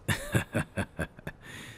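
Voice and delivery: light, mostly voiceless chuckle. Acted. Man turned down by the professor, amused chuckle. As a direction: laugh it off in short breaths. Professor turns down his proposal.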